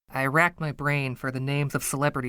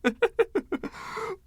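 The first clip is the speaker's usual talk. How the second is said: high, delighted giggle; a giddy little giggle, much higher. Acted. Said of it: "he giggled".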